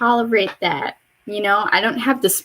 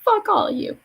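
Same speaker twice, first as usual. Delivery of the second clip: comic high-pitched cursing at everyone; mock curse; 13 semitones higher than usual. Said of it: "And I'm just like".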